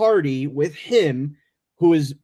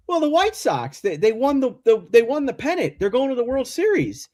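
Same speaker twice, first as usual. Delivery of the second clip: lightly voiced buddy, higher; a touch of an eager friend breaking the news; buddy explaining; 9 semitones higher than usual. Buddy explains pennant, voiced high.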